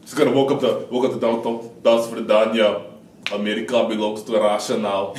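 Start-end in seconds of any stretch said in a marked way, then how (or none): 1.8-5.1 put-on Russian accent. Melania voiced as Russian agent.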